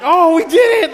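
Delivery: high, loud burst of joy; thrilled exclamation. Retold or imitated. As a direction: relive the winning moment with a high, delighted cry. Reliving the win announcement.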